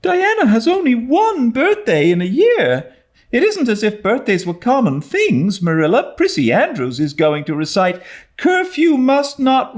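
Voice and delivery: put-on a girl pulling out every argument; pleading young Anne. A narrator's reading. Anne begging.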